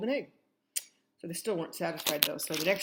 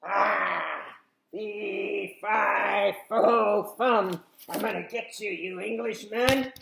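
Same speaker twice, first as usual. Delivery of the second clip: a growlier, beastly giant, louder; a new, beastlier giant voice, second try; better giant voice; 2 semitones higher, 7 dB louder, much slower than usual. Acted. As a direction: growl it like a weird bear. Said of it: "we could have a better giant, maybe".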